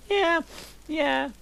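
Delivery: sadly. A narrator's reading. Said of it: "I said sadly".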